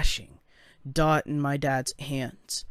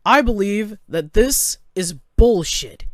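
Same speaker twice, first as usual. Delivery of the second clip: loud, higher indignant call; yelling across room; 5 semitones higher, 6 dB louder than usual. A narrator's reading. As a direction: project it angrily to someone far off. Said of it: "yelling across the room".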